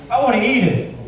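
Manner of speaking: put-on a goofy kid blurting it out; kid's voice. Kid voiced in classroom story.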